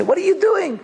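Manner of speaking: high, scornful jab; incredulous mock taunt; mocking neighbor. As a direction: sneer at them like a nosy neighbor. Neighbors mocking the newly religious.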